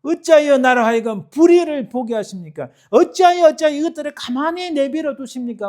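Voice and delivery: loud, high cry to God; impassioned, accusing outcry; shouted protest. Acted. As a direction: shout the questions, building to a peak. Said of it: "하고 막 고함을 치는 거예요".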